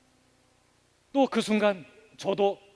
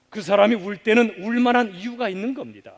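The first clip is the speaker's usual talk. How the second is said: ordinary talk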